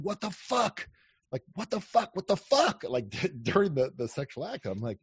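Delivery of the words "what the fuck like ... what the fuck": high-pitched retold what-the-fuck outburst; stunned disbelief, imitated in a high voice; imitated astonishment. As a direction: mimic her startled, incredulous cry, pitched up. Her startled outburst during sex.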